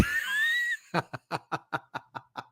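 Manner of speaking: very high burst of laughter; squealing laugh at a joke; shrieking laugh. Real, not acted. Cracking up at chat.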